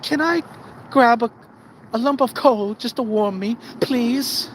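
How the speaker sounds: high, meek begging voice; pleading worker. Acted. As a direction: beg the boss, hammed-up and pitiful. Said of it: "going up to our boss going".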